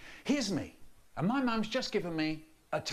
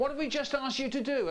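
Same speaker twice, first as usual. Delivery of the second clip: much higher, cross question; lightly voiced scolding parent; mock exasperated parent; 12 semitones higher, 4 dB louder, faster than usual. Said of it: "They say".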